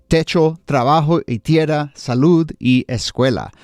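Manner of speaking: put-on quoting in a proud Spanish accent; Spanish phrases with an accented flourish. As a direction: show off your Spanish accent. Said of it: "flex my Spanish accent".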